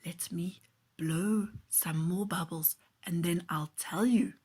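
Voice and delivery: hushed secretive whisper. Acted. Bubble man teases the boy, whispering. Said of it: "the man said in a whisper".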